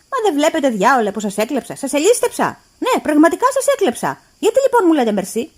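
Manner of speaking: furious. A narrator's reading. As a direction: scold her, building fury. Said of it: "Έγινα έξω φρενών".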